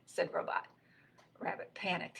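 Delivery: usual speaking voice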